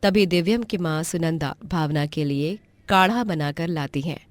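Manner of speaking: usual speaking voice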